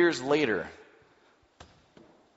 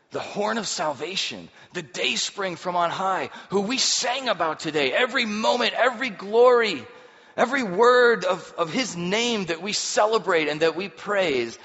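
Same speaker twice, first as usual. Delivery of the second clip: rising louder and higher with each phrase; building praise; 7 semitones higher, 6 dB louder than usual. Real, not acted. Preacher lifting the congregation to a ringing peak.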